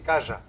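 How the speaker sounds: "calla" with a buzzing zh-like middle; zain variant. Shown as a demonstration. Lecturer showing the zain group's casa, buzzed middle. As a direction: say "calla" with a zh sound for ll. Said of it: "Los que eligieron la zain".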